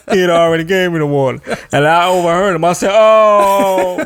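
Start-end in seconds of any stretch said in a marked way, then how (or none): 2.9-4.0 sudden higher, louder "Oh."; startled oh. Retold or imitated. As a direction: caught out, realising the mistake. Hears not to drink water.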